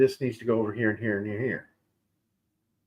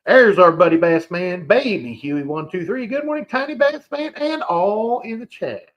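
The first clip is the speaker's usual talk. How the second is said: excited greeting; 6 semitones higher, 6 dB louder than usual. Real, not acted. Hyped greeting to chat member.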